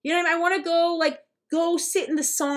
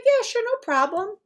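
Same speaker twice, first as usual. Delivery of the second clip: mock breezy approval; 8 semitones higher than usual. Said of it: "I'd be like".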